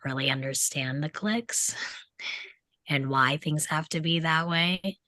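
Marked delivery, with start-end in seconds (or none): none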